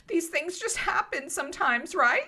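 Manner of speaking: high-pitched voice seeking agreement; reassuring girl. Acted. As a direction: reassure lightly in a high girlish voice. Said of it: "looks to Kiana reassuringly".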